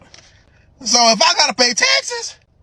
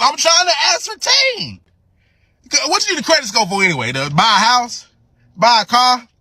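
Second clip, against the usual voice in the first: ordinary talk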